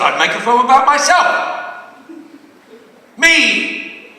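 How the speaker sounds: high, rising bellow; self-promoting shout. Acted. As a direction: yell it as a big punchline. Triumphant punchline answer to the filmmaker.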